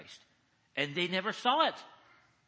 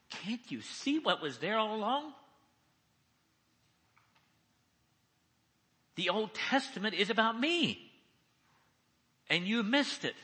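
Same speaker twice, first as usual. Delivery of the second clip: higher-pitched, incredulous reproach; exasperated rebuke; 7 semitones higher than usual. Acted. Preacher as Jesus chiding disciples who missed it, in exasperated reproach. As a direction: press the point home as an acted rebuke.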